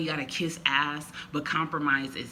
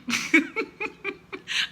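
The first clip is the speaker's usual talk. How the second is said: high, light giggle; a little self-amused laugh; 3 dB louder than usual. Real, not acted. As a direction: giggle at your own admission. Laughs at her own admission.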